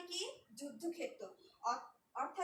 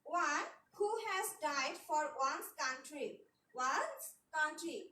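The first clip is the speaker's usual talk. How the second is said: slow dictation; much slower than usual. Shown as a demonstration. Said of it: "dictating a definition slowly".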